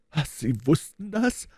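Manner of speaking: high, slow stunned question; aghast. A narrator's reading. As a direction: gasp it out in total disbelief. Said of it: "fragte er fassungslos".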